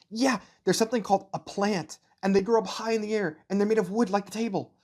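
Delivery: put-on high, bright child voice, words tumbling out; a little boy proudly showing off; eager young boy. Ainz shows his mother his new books, high eager child voice.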